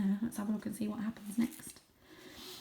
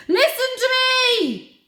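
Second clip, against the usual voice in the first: high, loud call for order; demanding; 14 dB louder than usual. Acted. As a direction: shout over the crowd. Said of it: "demanded the elder Paul".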